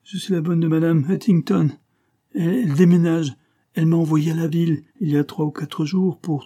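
high, whining torrent of complaint; whimpering French maid. A narrator's reading. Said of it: "pleurnicha-t-elle avec un fort accent français".

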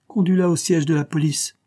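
officer taking charge; commanding order. A narrator's reading. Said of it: "commanda l'agent".

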